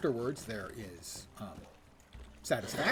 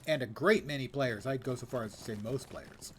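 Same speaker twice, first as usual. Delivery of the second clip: ordinary delivery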